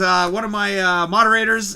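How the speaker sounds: usual speaking voice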